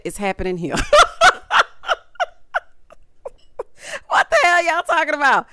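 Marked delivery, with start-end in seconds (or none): 0.8-1.9 loud shrieking laugh; high cackling laugh. Real, not acted. Reviewer cracking up at Troy's confessional, loud cackle. 4.1-5.5 laughing disbelief. Real, not acted.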